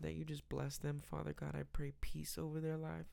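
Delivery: ordinary talk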